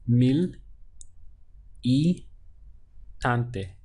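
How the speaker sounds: le- gante broken into syllables. Shown as a demonstration. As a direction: spell out le- gante slowly. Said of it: "So we have".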